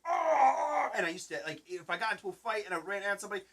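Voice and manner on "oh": loud high frustrated grunt; a mock childish cry of rage; angry kid grunt. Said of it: "I'd be like".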